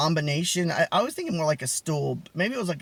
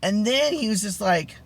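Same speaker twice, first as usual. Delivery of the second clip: ordinary delivery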